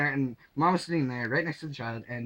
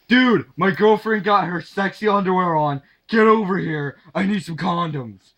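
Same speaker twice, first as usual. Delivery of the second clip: urgent friend; 3 semitones higher, 7 dB louder than usual. Retold or imitated.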